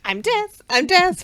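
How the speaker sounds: lightly hammed-up grim reaper chant; mock villain. Villain playing death in his head, high mock chant. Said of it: "in his head being like".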